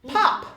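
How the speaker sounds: loud high pop; pop sound. A narrator's reading. Narrator making the elf reappear with a pop. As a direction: pop into place.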